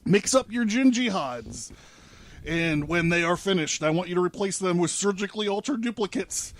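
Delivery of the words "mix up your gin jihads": ordinary delivery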